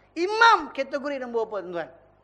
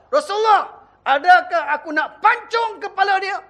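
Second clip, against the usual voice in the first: angry Umar; 6 semitones higher, 7 dB louder than usual. Acted. Umar offers to behead the man.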